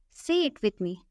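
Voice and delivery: usual speaking voice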